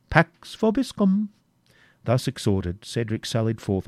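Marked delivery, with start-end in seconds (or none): 0.1-1.3 high, slow sing-song Latin blessing; jester's parting Latin. Acted. Wamba teasing his uncle with the Latin blessing, high and drawn out. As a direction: tease it out like a mocking fool. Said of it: "and remember".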